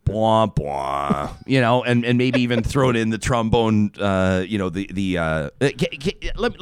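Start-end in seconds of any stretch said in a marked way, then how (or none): 0.0-1.3 low mock cowbell honk; low honking noise, bwah bwah; low cowbell imitation. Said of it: "everyone would have gone".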